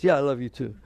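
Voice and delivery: perfunctory, careless answer; offhand reply. Shown as a demonstration. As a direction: toss it off as if it's no big deal. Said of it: "What if they say it like this?".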